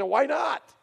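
loud, high mock-teen retort; challenging retort. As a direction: fire back as a pushy teenager. Girl challenges the family's correction.

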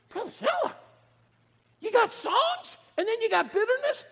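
a high, nudging mock congregant; mock questioner. Congregant teasing Brother Keller about songs and bitterness, in a high mock voice.

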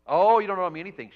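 high, loud imitated wail on the "oh"; hammed-up tearful outburst, rushed after the wail; mock crying. Friend refusing payment for the vet, mock crying. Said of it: "She got, started crying".

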